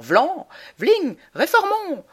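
high, loud rallying cry of a blowhard candidate; rousing refrain. Acted. Candidate rallying voters, triumphant loud cry. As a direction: belt it out like a campaign slogan. Comic refrain of a stump speech.

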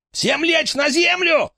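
high, panicked yell. Acted. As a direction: scream orders at them. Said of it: "заорал он".

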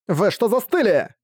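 high, urgent, slow shout. A narrator's reading. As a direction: yell to snap the troops awake. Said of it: "закричала Каска".

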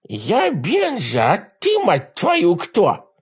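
high, slow, lisping little man; effeminate lisp. Acted. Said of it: "женоподобный шепелявый голос".

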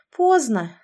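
plain reading voice

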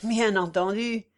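ordinary delivery, nothing marked

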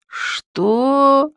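high, sleepy startled "what"; just woken, confused and high; suddenly jolted awake. Acted. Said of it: "сонным голосом спросил тот".

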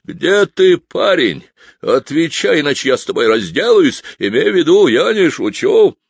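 yelling. Acted. Piper roars threats at the missing boy, yelling. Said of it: "заорал".

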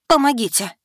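high call for help; cry for help. A narrator's reading. Calling for help.

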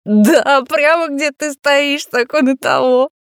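high, loud gleeful creep; bursting with creepy delight. A narrator's reading. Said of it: "подпрыгнул от восторга".

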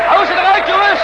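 high, loud shout to the men. Acted. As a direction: yell it over the crowd. Crowd scene, urging men on. (luid roepend)

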